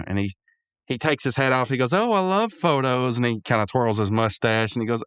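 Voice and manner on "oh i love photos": Pops voice. Retold or imitated. Voicing Pops.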